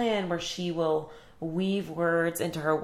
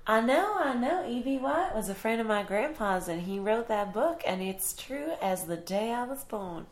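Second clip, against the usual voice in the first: a hint of a chirpy Iowa farm girl, higher at the start; a small-town farm girl proudly answering visitors; lightly voiced country farm girl; 4 semitones higher, 3 dB quieter than usual. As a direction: play the proud local girl, only lightly put on. Plays Fern in skit.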